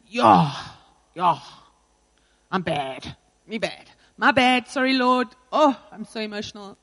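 hammed-up churchgoer's remorse; put-on emotional confession; mock emotional repentance. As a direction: imitate an over-emotional show of repentance. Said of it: "We love the whole emotional".

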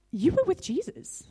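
high, pointed accusation; accusing woman. Retold or imitated. Woman confronting Peter about Jesus, accusing. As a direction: raise the pitch and accuse him outright. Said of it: "this woman comes up and".

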